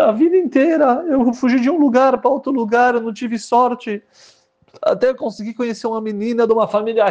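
high, earnest pleading confession; pleading groom. Acted. Groom confessing his past to the rabbi, high and pleading. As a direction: plead your hard life to the rabbi, pitched high.

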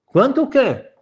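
high, playing-dumb groom; feigned innocence. Groom playing dumb when the rabbi demands payment, high and innocent. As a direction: pitch it up as if he has no idea.

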